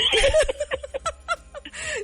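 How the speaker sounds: high, helpless real laugh. Real, not acted. Host cracks up at own stray British accent, high laughter. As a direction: break off into high-pitched laughter.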